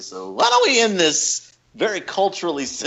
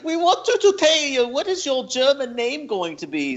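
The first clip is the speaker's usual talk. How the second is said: put-on higher-pitched German-accented teacher; 9 semitones higher than usual. German teacher asking students for their names, German accent. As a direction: imitate a German teacher addressing class. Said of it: "we had a German teacher".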